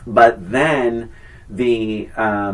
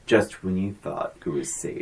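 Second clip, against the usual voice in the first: movie trailer voice; 6 semitones lower, 8 dB quieter than usual. Retold or imitated. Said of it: "part two is".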